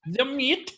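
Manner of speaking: hammed-up Northern Irish "the mate" as "the meet", high; mimicking "mate". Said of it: "You're like".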